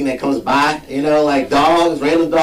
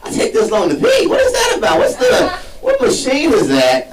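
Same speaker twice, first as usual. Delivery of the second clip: whining gas pump. Retold or imitated. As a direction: complain like a fussy machine. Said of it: "like, pump, like".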